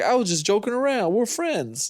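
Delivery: high-pitched, backpedaling protest; mock pleading. Man at gunpoint claims he was joking, mock pleading. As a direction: imitate a man hastily backing down, voice jumping up high.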